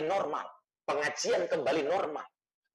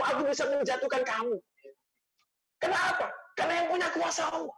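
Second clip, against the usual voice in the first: high-pitched, insistent admonishment; stern rebuke; 8 semitones higher than usual. Retold or imitated. God admonishing the preacher for overreaching, retold as a high stern rebuke. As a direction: press the reproach home, voice raised in pitch. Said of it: "ini cara Allah menegur saya".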